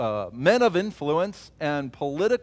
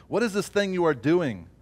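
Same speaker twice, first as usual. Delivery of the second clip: louder, challenging sneer; open mockery; 5 semitones higher, 4 dB louder than usual. The mockers openly jeering at the wall builders, louder. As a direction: throw the taunt at them. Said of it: "they mocked us and despised us".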